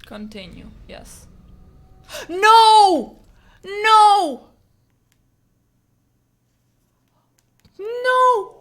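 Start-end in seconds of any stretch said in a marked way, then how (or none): 1.3-2.2 horrified gasp. Real, not acted. Sees save lost. 2.3-8.5 high, loud cries of no; anguished denial. Real, not acted. Game seems to wipe save.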